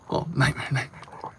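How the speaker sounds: unvoiced whispered chant. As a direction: whisper it to yourself. Whispered repetition.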